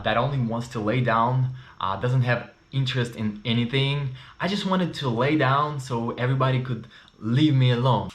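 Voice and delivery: ordinary talk, nothing marked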